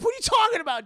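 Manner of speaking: high, loud disbelief; mock incredulous outburst. Storyteller reacting to Jack's remark, incredulous mock yelling. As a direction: challenge him as if he were absurd. Disbelief at Jack finding it cool.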